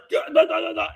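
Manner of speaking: high-pitched babbling imitation; mock gibberish. Imitating the woman he hit, high mock gibberish. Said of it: "it was like she was gibberish".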